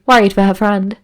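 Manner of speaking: ordinary delivery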